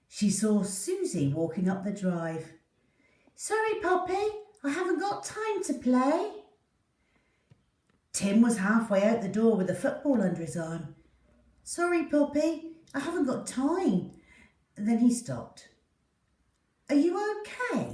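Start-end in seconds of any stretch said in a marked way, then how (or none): 3.4-6.3 put-on higher, breezy girl's voice, hurrying off; a busy girl with no time to play; young girl voice. Susie hurrying past Poppy, high girlish voice. 11.6-14.1 put-on higher, hurried boy's voice; a boy rushing out with his football; young boy voice. Tim dashing past Poppy, high young voice. 16.9-17.9 put-on higher, gentle boy's voice asking kindly; a boy suddenly worried for his dog; caring young boy. Tim stopping to check on Poppy, high and caring.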